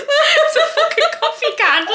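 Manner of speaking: high, laughing imitation of a tearful friend; hammed-up mock crying, laughing; teasing the friend who cried, in a mock tearful voice; mock teary wail. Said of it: "and she's crying".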